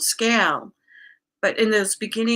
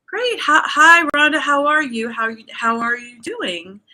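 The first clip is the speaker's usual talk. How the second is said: high, louder greeting imitating a coach; coach's cheery greeting; 5 semitones higher, 5 dB louder than usual. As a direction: greet a client brightly, as her coach would. Coach greeting client in retold call.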